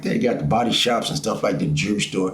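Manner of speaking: ordinary talk, nothing marked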